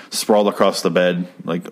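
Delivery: ordinary talk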